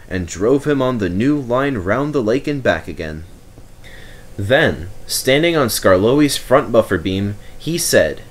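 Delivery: ordinary delivery, nothing marked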